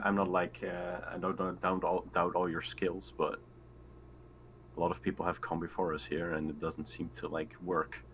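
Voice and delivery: normal talking voice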